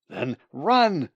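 loud, high urgent order; screamed. A narrator's reading. Bird's brain orders him to flee, screamed. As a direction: cry out the command in desperation. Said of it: "screamed his brain".

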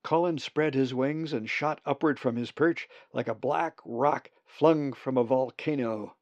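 ordinary delivery

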